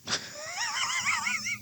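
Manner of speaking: high, quiet squealing laugh; real laughing fit. Real, not acted. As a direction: crack up helplessly at your own flub. Cracks up at own garbled line.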